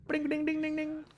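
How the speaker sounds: high sing-song "ding" chimes; bell imitation. Mimics a bell when a pick lands.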